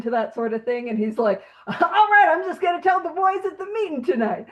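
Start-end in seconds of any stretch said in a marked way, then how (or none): 1.7-4.5 much higher, laughing mock cheer; oblivious cheer. Oblivious Bill will tell the boys instead, mock-cheerful. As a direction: imitate blithe enthusiasm with a laugh in it.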